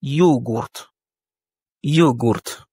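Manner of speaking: yogourt in Russian, stress on the O; Russian pronunciation. Shown as a demonstration. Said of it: "l'accent tonique est mis sur le O".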